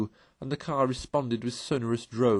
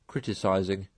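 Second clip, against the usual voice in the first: ordinary delivery, nothing marked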